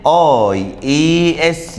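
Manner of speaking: slow spelling out. Shown as a demonstration. Teacher spelling the ending slowly for students. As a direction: spell each letter out very slowly.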